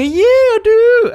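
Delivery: loud, high, drawn-out mock agreement; overdone eagerness that sounds insincere; sarcastic. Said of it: "I can't switch off my sarcasm".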